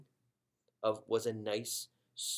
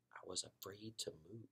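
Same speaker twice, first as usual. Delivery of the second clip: much softer, barely voiced; hushed; 14 dB quieter than usual. A narrator's reading. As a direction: breathe it out, frozen in place. Frozen hamster behind cabinet.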